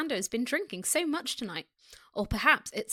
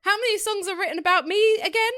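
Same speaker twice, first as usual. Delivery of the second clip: high, louder teasing question; 8 semitones higher, 5 dB louder than usual. A narrator's reading. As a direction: tease with mock innocence. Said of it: "his tone teasing".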